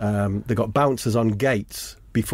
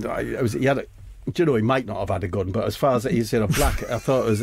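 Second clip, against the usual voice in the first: normal talking voice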